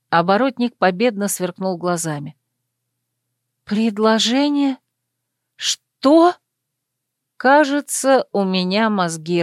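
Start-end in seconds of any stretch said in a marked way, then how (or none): 3.7-6.3 higher questioning voice, jumping up at the end; stunned echo. A narrator's reading. Heroine stunned by her lover's proposal, incredulous. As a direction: echo it slowly, then burst out in disbelief.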